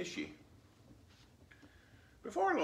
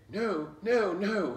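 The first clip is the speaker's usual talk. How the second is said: sobbing; 7 semitones higher, 8 dB louder, slower than usual. A narrator's reading. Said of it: "he sobbed".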